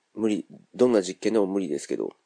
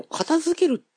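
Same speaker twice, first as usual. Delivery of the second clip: 片付ける said much higher, singled out; word singled out; much slower than usual. Shown as a demonstration. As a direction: lift 片付ける high to set it apart. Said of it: "使い分けっていうのが一応あって". (「片付ける」を高く強調して)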